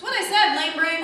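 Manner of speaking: loud insult. Acted. Tin Man snaps insult.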